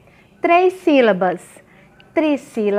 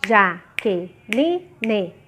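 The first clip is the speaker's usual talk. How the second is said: "Jaqueline" in four short syllables with pauses between; sílabas separadas; 4 semitones lower, 4 dB quieter, much slower than usual. Shown as a demonstration. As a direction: count out "Jaqueline" syllable by syllable, slowly. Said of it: "Eu já vi crianças fazendo assim também". ("Jaqueline" sílaba por sílaba, com pausas)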